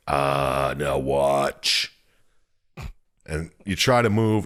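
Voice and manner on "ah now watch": a hint of a low demon voice; low mock demon voice; lightly voiced demon. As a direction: growl it a little lower like the darkness. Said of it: "The fucking darkness is like".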